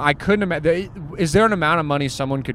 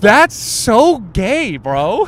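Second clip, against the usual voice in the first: mocking drawl; 4 semitones higher, 5 dB louder, much slower than usual. Real, not acted. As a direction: tease him with a long high drawl. Mocking friend's answer.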